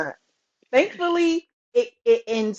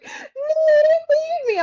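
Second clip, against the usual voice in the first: high, wailing imitation of a tearful boy; mock crying child; 4 dB louder than usual. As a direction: wail it like a little kid being left behind. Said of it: "he busts out crying and he's like".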